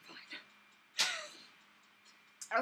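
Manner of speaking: ordinary talk, nothing marked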